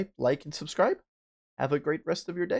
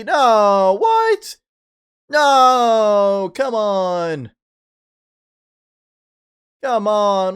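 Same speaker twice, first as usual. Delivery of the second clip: loud, drawn-out cries of protest; dismayed outcry; 3 semitones higher, 9 dB louder, much slower than usual. Real, not acted. Player finding no chapter three on Steam, dismayed outcry. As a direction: wail it loudly in disbelief.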